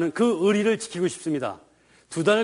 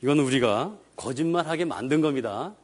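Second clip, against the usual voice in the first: ordinary talk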